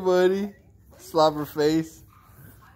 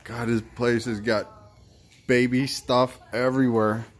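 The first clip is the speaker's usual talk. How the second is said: normal talking voice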